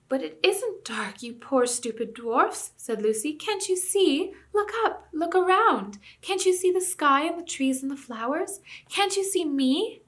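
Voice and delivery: put-on high, slow, urgent young girl; pleading girl. Lucy begging the dwarfs to see the sky, high and slow. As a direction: beg them to look around.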